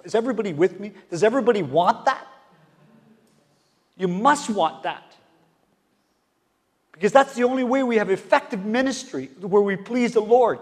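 loud, rising preacher's call; preaching. Acted. Preacher rousing the congregation, full-force preaching. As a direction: fire up the congregation.

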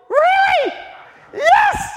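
very high, loud joyful shout; overjoyed shout. Retold or imitated. Preacher hearing the news, overjoyed high shout. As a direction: scream it with delight.

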